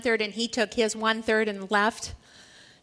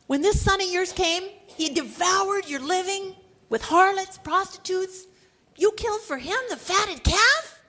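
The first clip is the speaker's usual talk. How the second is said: higher, louder bitter accusation; indignant son; 7 semitones higher, 3 dB louder than usual. Acted. Elder son accusing his father: higher indignant outburst. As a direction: throw the brother's sins at the father. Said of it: "He answered his father".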